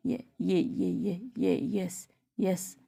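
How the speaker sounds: stuck repeating before finally saying yes; shy child stammering a yes. Acted.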